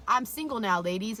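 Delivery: mock stage shout; stage call-out. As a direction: announce it to a concert crowd. Singer calling out to crowd.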